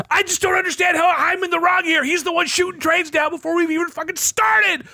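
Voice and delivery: high, agitated rant; indignant high-pitched complaint; freaking out. Retold or imitated. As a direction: retell the panic at full pitch.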